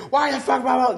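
high-pitched imitation of a screaming woman; mock screaming. Guest retells a woman yelling at a motel window, mock screaming. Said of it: "She starts screaming at this window".

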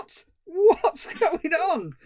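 high, very slow Cockney what's going on, laughing; mock Cockney panic. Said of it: "this Cockney sidekick".

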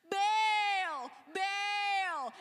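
high, loud pleading cries to a god; frantic calling out. Acted. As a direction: beg Baal loudly, over and over. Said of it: "So they're like".

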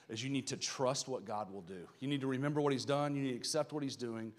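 ordinary delivery

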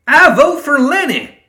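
very high, loud, drawn-out shout; triumphant yell from Ma. A narrator's reading. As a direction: belt out the vote. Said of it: "Ma shouted".

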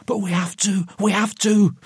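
very high, insistent plea; desperate pleading. A narrator's reading. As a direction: plead helplessly, pitch climbing.

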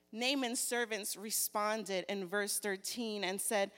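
usual speaking voice

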